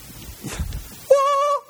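sudden high-pitched laugh; real high laugh at the disciples; high squeal of laughter. Real, not acted. Preacher laughing at Philip's request.

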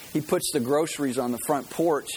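normal talking voice

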